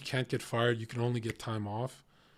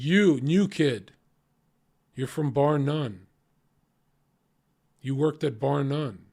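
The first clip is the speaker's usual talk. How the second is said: loud, drawn-out address to a newcomer; retold doorman singling someone out, slow and loud; imitating doorman's call-out; 2 semitones higher, 7 dB louder, slower than usual. Head doorman to the new bouncer at Aura, loud call-out.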